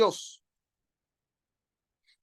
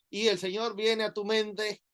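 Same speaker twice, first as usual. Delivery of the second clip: normal talking voice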